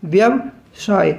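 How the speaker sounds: व्यवसाय very slowly, in two parts; slow dictation. Shown as a demonstration. Teacher dictates a word for learners to spell, slow and split. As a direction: break व्यवसाय into 'vyav' and 'saay', drawn out.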